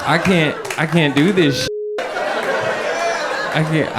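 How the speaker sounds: a stoned driver losing it at a cop; freaking out. Retold or imitated. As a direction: blurt it out in rising panic. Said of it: "I didn't say that".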